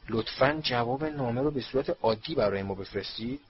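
usual speaking voice

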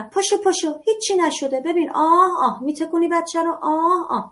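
put-on high brisk mom hustling the child up; jolly the kid out of crying; dismissive mother. Mother shaking her fallen child up, brushing it off. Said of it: "این کلام آشناس براتون".

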